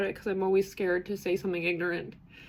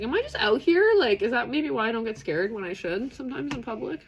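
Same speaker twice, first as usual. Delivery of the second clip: much higher, louder, wide-eyed wondering; amazed wondering; 4 semitones higher, 5 dB louder than usual. Retold or imitated. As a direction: ask it in sudden amazement. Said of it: "I was like".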